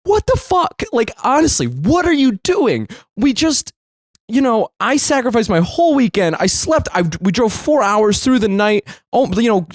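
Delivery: high-pitched, exasperated tirade; freaking out. Retold or imitated. Son confronting dad after losing it, freaking out. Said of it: "I'm freaking out".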